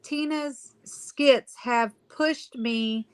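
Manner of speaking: usual speaking voice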